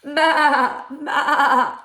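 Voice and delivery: high, loud wavering goat cry; goat bleat. A narrator's reading. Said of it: "said the goat".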